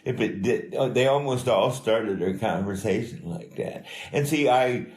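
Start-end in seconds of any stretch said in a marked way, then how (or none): none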